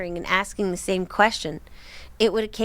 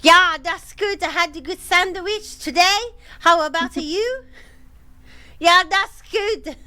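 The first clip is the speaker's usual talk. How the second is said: loud, high, goofy fake German accent; shouted German accent; 9 semitones higher, 5 dB louder than usual. Man shouting at the knocking visitor about his day, shouted mock German. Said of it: "then started shouting in German".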